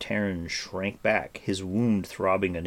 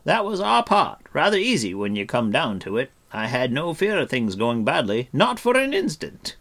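carefree; 6 semitones higher, 7 dB louder than usual. A narrator's reading. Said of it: "with a carefree shrug".

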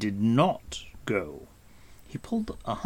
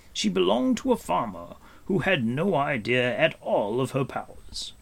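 higher, measured storyteller with gravity; Gwydion; 5 semitones higher, 3 dB louder than usual. Acted. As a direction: recount a grave history with emphasis. Gwydion tells Hen Wen's history.

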